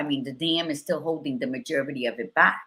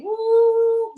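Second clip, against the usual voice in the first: high, loud, drawn-out "woo"; playful whoop; 8 dB louder than usual. Acted. Joking about her jiggle, a playful whoop. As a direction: let out a high, ringing whoop.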